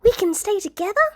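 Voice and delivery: put-on high small child voice, hopeful; a young girl asking hopefully; little girl Eri. Eri asks June in a high child's voice.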